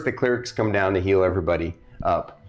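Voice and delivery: normal talking voice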